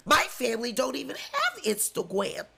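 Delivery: ordinary talk, nothing marked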